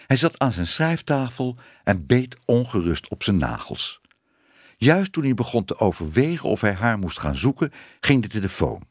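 plain reading voice

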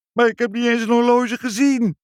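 high, loud protest; desperate protest. A narrator's reading. Meneer Snack protests his innocence to the parents. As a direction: plead his innocence in anguish.

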